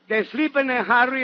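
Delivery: ordinary talk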